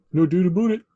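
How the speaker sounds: Canadian "aboot", played up; mock Canadian accent. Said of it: "That's what a Canadian says".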